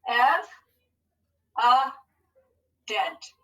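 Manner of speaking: EV, A, DENT as separate beats; broken into syllables. Shown as a demonstration. Teacher breaks a word up for pupils, syllable by syllable. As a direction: pause between Ev, a and dent. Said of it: "Let's break it into syllables".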